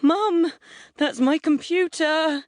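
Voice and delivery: high-pitched terror. A narrator's reading. Son panicking at his mum, high-pitched with terror. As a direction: call out in a terrified, high voice. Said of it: "His voice has gone all high-pitched with terror".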